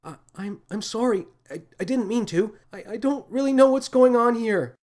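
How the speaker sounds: high, breathless, frightened stammer; a scared girl stammering an apology to the captain; stammering in shock. A narrator's reading. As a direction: stumble over words in fear. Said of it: "She stammered as she tried to catch her breath".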